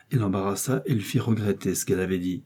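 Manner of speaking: usual speaking voice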